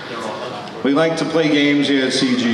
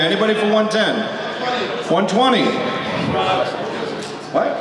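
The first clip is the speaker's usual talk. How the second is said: auctioneer; 4 semitones higher than usual. Real, not acted. Auction calling bids.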